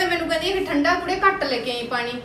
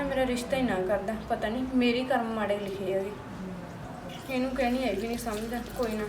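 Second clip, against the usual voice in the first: sorrowful lament; 4 semitones lower, 6 dB quieter than usual. Acted. Wife alone, lamenting her hard married life, sad and quiet. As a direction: lower and softer, weary complaint.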